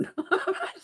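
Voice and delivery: a high burst of laughter; laughing. Real, not acted. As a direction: laugh out at the stuck lid. Laughing at stuck makeup.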